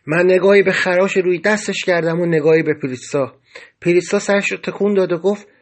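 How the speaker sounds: ordinary delivery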